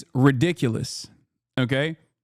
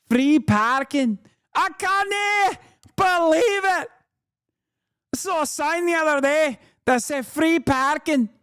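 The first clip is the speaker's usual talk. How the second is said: mock Scottish accent; 9 semitones higher than usual. Streamer reading out her tweet in character, high Scottish parody. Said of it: "That is also a real tweet from E Fatlo".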